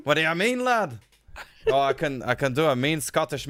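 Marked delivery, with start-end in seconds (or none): 0.0-1.0 higher, hammed-up Irish brogue; mock Irish accent. Answering co-host in kind, put-on Irish accent. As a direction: throw out a high mock-Irish challenge. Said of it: "That's some Irish one".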